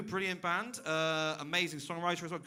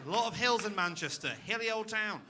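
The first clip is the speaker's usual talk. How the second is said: ordinary talk, nothing marked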